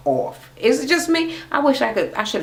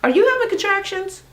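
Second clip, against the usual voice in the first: surprised question. Retold or imitated. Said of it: "looked at her sister".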